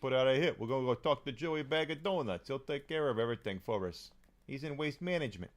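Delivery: een vleugje New York-accent, gangster die een klus regelt; lightly done New York accent. Shown as a demonstration. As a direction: speel een lichte New York-maffioso. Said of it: "my New York accent".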